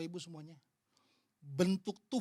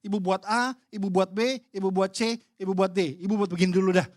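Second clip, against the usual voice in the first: louder, ticking off each step; tactical checklist; 8 dB louder than usual. Retold or imitated. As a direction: list the steps like a consultant's checklist. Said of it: "taktis gitu ya". (daftar langkah taktis, lebih keras)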